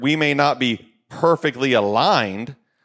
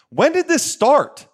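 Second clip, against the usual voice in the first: exasperated outcry; 9 semitones higher, 4 dB louder than usual. Real, not acted. Exasperated rhetorical question.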